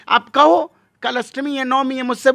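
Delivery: loud, high emphatic challenge. Real, not acted. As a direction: say it sharply and high. Heated rhetorical challenge. (ऊँची, जोरदार आवाज़ में चुनौती)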